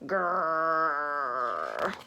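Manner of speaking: low, slow drawn-out grrr; playful dog growl. Acted.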